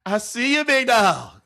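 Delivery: hyped, playful shout-out; playful call-out. Pretend. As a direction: call out to the big receiver. Calling out to the receiver in play.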